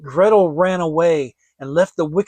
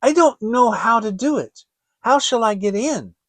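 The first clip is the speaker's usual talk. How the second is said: feigned innocence; 6 semitones higher, 4 dB louder than usual. Acted. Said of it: "perceived her intentions and said".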